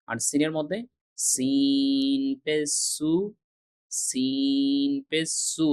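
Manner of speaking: সিন পেশ সু drawn slow with a strong hissed s; sharp hissed seen. Shown as a demonstration. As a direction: stretch সিন পেশ সু slowly, hissing the s. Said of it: "এই যে সু sound হচ্ছে". (জোরালো স-ধ্বনিতে ধীরে উচ্চারণ)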